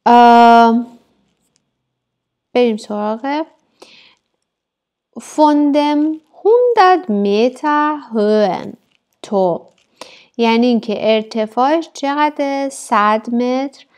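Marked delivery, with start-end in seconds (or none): 5.2-9.6 high and slow, word by word; slow raised classroom reading; slow dictation reading. Shown as a demonstration. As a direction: lift and stretch each word for the class. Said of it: "teacher reads German slowly".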